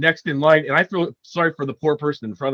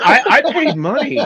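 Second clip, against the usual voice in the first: baffled complaint; 9 semitones higher, slower than usual. Retold or imitated. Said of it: "is still sitting there going".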